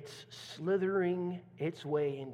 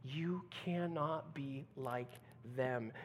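quiet warning; 4 semitones lower, 8 dB quieter, slower than usual. Acted. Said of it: "Jesus is saying".

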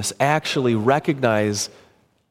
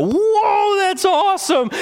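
high squeal of delight; thrilled whoa, much higher; delighted gift recipient; 3 dB louder, slower than usual. Retold or imitated. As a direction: gush over the new pen. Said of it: "and they go".

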